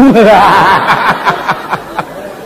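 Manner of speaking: rolling laughter; amused laughter; laughing. Real, not acted. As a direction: laugh along at the joke. Laughing at own joke.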